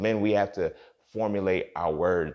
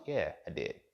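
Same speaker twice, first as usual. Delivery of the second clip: ordinary talk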